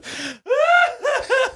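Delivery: very high laugh that turns into a wail; imitated wailing laugh. Imitating her friend Jen's laugh that sounded like wailing in tears. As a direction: laugh so hard it sounds like sobbing. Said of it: "it sounded like she was wailing".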